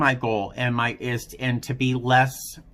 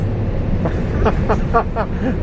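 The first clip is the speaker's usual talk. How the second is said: disbelieving laugh; 11 semitones higher, 7 dB louder than usual. Real, not acted. Incredulous laugh at her threat.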